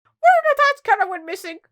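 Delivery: loud, high jumbled blurt; panicked blurting. Acted. Cook confessing to the Queen, panicked. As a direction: blurt it out in one high rush.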